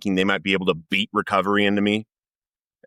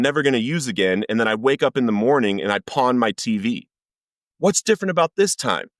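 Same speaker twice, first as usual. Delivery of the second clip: higher-pitched, exasperated challenge to God; pleading anger, voice pitched up; retold angry upset; 4 semitones higher than usual. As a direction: re-enact an upset demand for answers. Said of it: "and angry".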